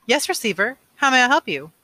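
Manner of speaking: a brisk voice through the wall speaker; prompt speaker voice. Acted. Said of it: "The voice spoke promptly".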